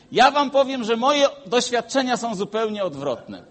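loud, high preaching build; rising preaching. Acted. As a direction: proclaim the point boldly.

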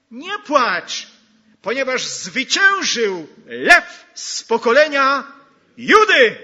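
triumphant full-voice proclamation; proclaimed shout. Acted. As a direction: call it out over the whole hall. Preacher proclaims the comfort.

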